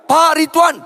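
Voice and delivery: high, urgent repeated call; acted pleading shout; desperate calling out. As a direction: call after someone who is ignoring you. Parent calls to ignoring pastor.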